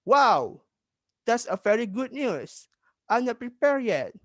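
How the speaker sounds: high, acted surprise with a loud "Wow"; surprised reply. Classmate hearing the English test has moved, acted surprise. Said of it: "Ardi terjawab dengan terkejut".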